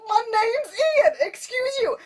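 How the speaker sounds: high, drawn-out indignant whine; offended intern. Acted. Intern correcting Darcy on his name, high offended whine. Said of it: "he keeps getting offended".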